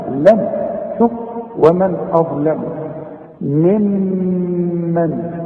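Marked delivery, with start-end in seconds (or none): 1.6-5.4 ممن with a long held nasal hum on the doubled meem; nasalised ghunna on ممن. Shown as a demonstration. Teacher shows the student the ghunna in ممن, slow held nasal. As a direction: hold the ghunna of ممن long, two counts. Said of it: "الميم الثانية عليها الشدة".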